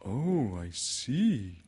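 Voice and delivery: slow, wondering bear; lightly voiced storybook bear; Pooh. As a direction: respond with mild awe in character. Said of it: "said Pooh".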